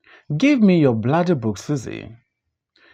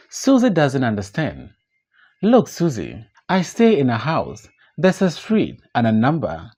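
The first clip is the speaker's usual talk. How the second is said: ordinary talk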